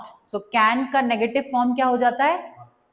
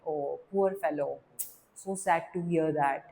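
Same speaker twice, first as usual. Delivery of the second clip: softer, slow falling sympathy on poor fellow; calm sympathy; 5 semitones lower, 7 dB quieter, much slower than usual. Shown as a demonstration. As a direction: answer gently, as if hearing sad news. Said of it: "जब हम आराम से answer करते हैं".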